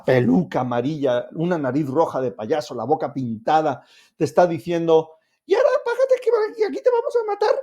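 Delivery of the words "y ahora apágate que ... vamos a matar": very high squeaky clown voice; cartoonish falsetto that makes the threat absurd; silly changed voice. Shown as a demonstration. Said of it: "no con ese tono de voz".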